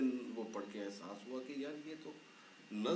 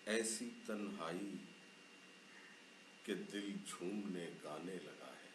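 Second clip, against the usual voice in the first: poem recitation; 3 semitones lower than usual. Acted. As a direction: let each line linger. Poet recites new ghazal.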